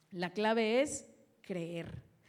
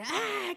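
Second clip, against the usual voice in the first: a sudden high shout of "ah"; mock yell; 10 semitones higher, 3 dB louder than usual. Shown as a demonstration. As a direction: show the wrong idea of conviction with a yell. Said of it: "convicción, por ejemplo, es gritar".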